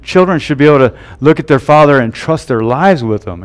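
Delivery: ordinary delivery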